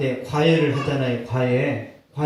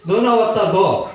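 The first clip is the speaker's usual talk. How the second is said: loud, higher jeer; teasing comrades; 3 semitones higher, 5 dB louder, slower than usual. Retold or imitated. Barracks mates jeering at him, loud teasing call.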